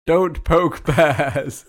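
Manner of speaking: very slow, laughing mock commandment; mock solemn rule. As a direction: spell out the rule slowly. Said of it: "rule two of living in this New England".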